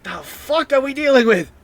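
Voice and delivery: high, loud bewildered character voice; alarmed yell. Acted. Gunman to allies after a bullet came back, alarmed high yell. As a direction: cry out high in disbelief.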